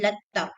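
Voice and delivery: "letter" as "let-ta", doubled T; shadda T. Shown as a demonstration. Teacher demonstrating shadda, doubled T in "letter". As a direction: lean on the held T in "letter". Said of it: "like that".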